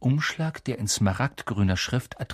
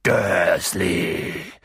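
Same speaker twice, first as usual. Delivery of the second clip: dröhnend; 8 semitones lower, 3 dB louder than usual. Acted. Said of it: "dröhnte er".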